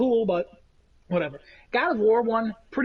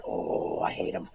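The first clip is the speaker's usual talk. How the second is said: mock whisper of disgust; a private whispered grumble; whispered aside; 6 dB quieter than usual. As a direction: breathe it out under your breath. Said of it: "and I'm like".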